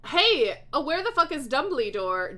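loud, high opening yell, then easing off; angry shout. A narrator's reading. Draco demanding to know where the headmaster is, shouting angrily. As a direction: call out crossly in a read-aloud shout. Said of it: "Draco shouted angrily".

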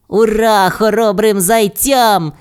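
drawn-out, high-pitched celebration; cheering. A narrator's reading. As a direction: cheer the heroes as a crowd would. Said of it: "пролунало в лісі".

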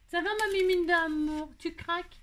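high-pitched doting coo; cooing pet talk. Real, not acted. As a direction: greet a beloved cat in a high, sweet lilt. Cooing to her pet cat.

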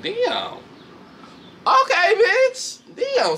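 high, slow, drawn-out "Okay, bitch"; mock shock. Vlogger recapping the fight, high and drawn out in mock awe.

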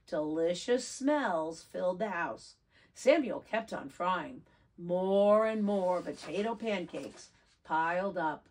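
ordinary delivery

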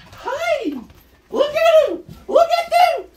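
very high delighted cry; excited squeal. Real, not acted. Owner watching the two dogs meet, high delighted squeal.

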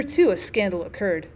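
plain reading voice

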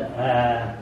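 usual speaking voice

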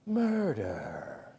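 low, hushed, drawn-out true-crime narrator; TV murder-show voice. Retold or imitated. Preacher mimicking murder shows for the congregation, low and ominous. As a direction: drop it deep and soft for suspense. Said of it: "That's how they say it on the shows".

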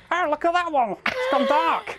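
high squawky penguin voice; penguin impression. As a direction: squeak it out like a chirpy penguin. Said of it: "Good penguin impression".

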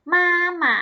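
มาหมะ loud, high long มา, short falling หมะ; model pronunciation. Shown as a demonstration. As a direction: sound out มาหมะ high and clear for learners. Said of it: "ออกเสียงตามเหล่าชื่อนะคะ".

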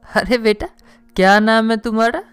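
usual speaking voice

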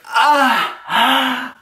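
high, drawn-out breathy "ah"; acted swooning gasp. Reacting to the third grab, high swooning gasp. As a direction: play up a high, overcome gasp.

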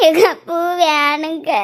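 very slow, sobbing plea; tearful, dragged-out whine; crying. Acted. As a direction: wail the request out slowly through tears. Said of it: "அழாத".

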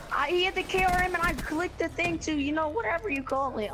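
frustrated rant. Real, not acted. Frustrated after dying.

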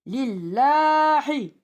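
"Lillahi" chanted slowly with a light, front "llaa"; thin lam recitation. Shown as a demonstration. Teacher showing the esre rule, "Lillahi" with thin lam. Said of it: "İnce".

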